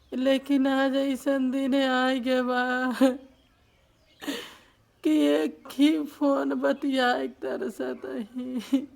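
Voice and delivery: real slow, loud tearful lament; crying. Real, not acted. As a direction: wail the words out slowly. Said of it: "एक रोइए मत". (रोते हुए, खींच-खींचकर)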